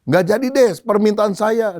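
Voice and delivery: high, hurried backing down; acted startled farmer. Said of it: "Uh, petani ini kaget". (petani kaget, buru-buru membatalkan)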